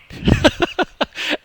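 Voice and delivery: a sudden high, airless burst of laughter; breathy laugh. Real, not acted. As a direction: laugh it out without voice. Laughs mid advice about fights.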